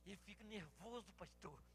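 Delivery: mother hushing the pastor; quiet voice. Retold or imitated. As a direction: tell him softly, afraid of the boy. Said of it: "se a gente falar alto".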